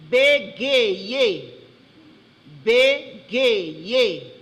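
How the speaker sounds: BÉGAYER loud, in drawn-out separate syllables; careful dictation. Shown as a demonstration. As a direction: announce "Bégayer" loudly, syllable by syllable. Spelling bee pronouncer gives the word.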